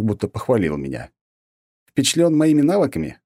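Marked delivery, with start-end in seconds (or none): 1.9-3.2 higher, louder mocking question; smirking. A narrator's reading. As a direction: needle him. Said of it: "усмехнулся я". (насмешливо, с вызовом)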